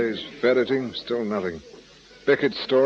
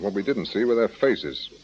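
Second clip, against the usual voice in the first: ordinary delivery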